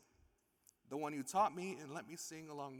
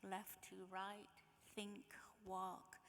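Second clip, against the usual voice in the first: higher, softer, slow dreamy reading; soft poem reading; 5 semitones higher, 8 dB quieter, slower than usual. Acted. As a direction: let it drift, wistful and quiet. Reads a letter aloud.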